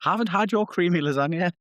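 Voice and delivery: suggestive tease. Pretend. Suggestive teasing about lasagna.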